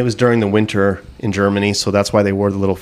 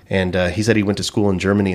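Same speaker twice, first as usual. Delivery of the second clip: usual speaking voice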